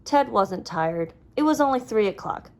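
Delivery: plain reading voice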